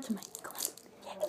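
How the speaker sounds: hushed, secretive whisper. Real, not acted. Begging viewers to subscribe.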